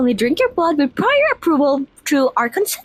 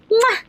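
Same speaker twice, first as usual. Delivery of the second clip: kiss sound; 8 semitones higher than usual. Acted. Vampire girl blows a kiss.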